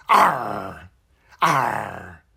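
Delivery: low growled pirate arr, loud. Shown as a demonstration. Teacher demonstrating the bad guy's core sound, a loud low pirate growl. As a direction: tighten the throat and growl it low. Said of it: "the core sound is".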